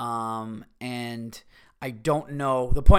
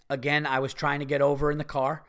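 ordinary talk

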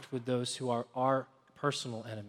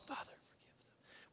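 breathy whisper of a dying man; hushed plea from the cross; whispered prayer; 25 dB quieter than usual. Acted. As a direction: whisper it, pleading. Jesus's prayer voiced on cross.

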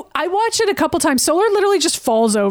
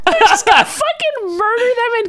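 high-pitched, emphatic disbelief; incredulous outburst; 8 semitones higher, 3 dB louder than usual. Real, not acted. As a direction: exclaim it, voice shooting up. Exclaims at the planned killing.

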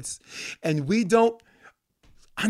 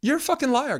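raised, furious accusation; angry outburst; 8 semitones higher than usual. Retold or imitated. Host ranting at a news network, fuming outburst. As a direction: spit it at them like a liar caught out. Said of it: "And I'm like".

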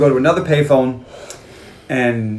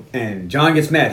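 ordinary talk

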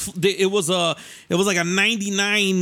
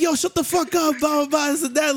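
high-pitched mock telling-off; retold irritation in a raised voice; imitated angry driver; 9 semitones higher than usual. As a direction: mimic a man snapping back from his car, pitched up. Imitates armed driver snapping back.

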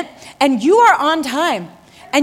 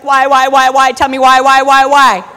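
louder, insistent whining why; demanding why; 3 semitones lower, 6 dB louder than usual. Acted. Believer demanding answers from God, loud insistent whys. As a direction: hammer each why louder. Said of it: "You're like".

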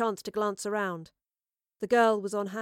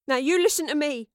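raised, louder demand to be heard; angry confrontation; 6 semitones higher, 6 dB louder than usual. A narrator's reading. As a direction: stand up to her firmly. Astrid confronts the armed murderess.

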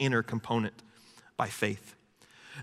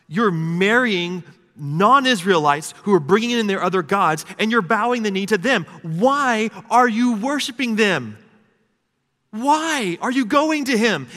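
raised, high-pitched exasperated plea; frustrated God; 8 semitones higher, 6 dB louder than usual. Retold or imitated. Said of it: "just frustrated in Malachi 'cause he's like".

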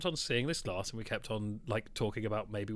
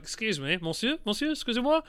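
higher, pleading, mock-polite "monsieur"; polite tourist plea; 9 semitones higher, 4 dB louder than usual. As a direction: beg the waiter nicely in bad French. Retelling his polite request to barman.

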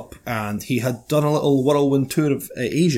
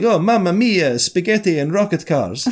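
higher, sing-song cartoon Italian; lightly hammed-up Italian impersonation; mock Italian accent; 5 semitones higher, slower than usual. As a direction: put on a silly Italian accent. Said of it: "that they were Italian Elon Musk".

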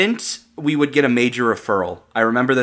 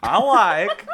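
Borat impression; 8 semitones higher than usual. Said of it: "Borat quote".

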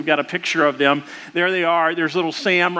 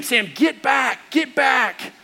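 put-on slow, higher shout at the boy; yelling; 7 semitones higher, much slower than usual. Dad shouting at his son to move away before the brick, slow higher yell. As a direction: bark a warning to get back. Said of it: "And I yell at Sam".

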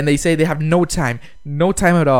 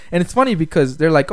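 ordinary delivery

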